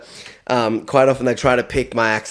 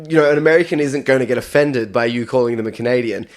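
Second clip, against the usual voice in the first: ordinary delivery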